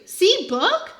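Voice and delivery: put-on high, loud toddler voice. A narrator's reading. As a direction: sound like a proud little toddler.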